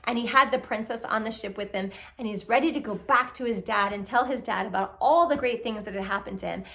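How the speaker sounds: ordinary delivery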